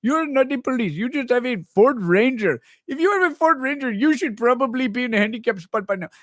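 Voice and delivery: put-on pleading shop owner in a Pakistani accent. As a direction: put on a thick Pakistani accent, protesting to a customer. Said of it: "Pakistani owners of the convenience store".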